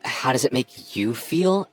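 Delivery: ordinary talk, nothing marked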